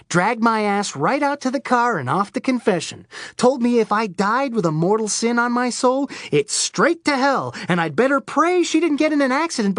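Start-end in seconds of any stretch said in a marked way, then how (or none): none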